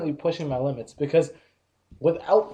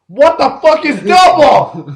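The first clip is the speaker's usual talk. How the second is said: loud, high, disbelieving outburst; incredulous outrage about a confusing order; exasperated rant; 8 semitones higher, 12 dB louder, slower than usual. Real, not acted. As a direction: vent the frustration at full volume.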